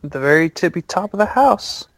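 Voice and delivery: put-on high-pitched little girl voice. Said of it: "the little girl goes".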